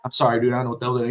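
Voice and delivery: rattled off fast and soft, imitating Tua; quick mumbled apology. Imitating the quarterback, a fast sheepish apology. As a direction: rush the apology under your breath. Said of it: "Tua will go".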